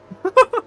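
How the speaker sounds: shrill laughing burst; high squeal of laughter. Real, not acted. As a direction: laugh in disbelief. Laughing after crash.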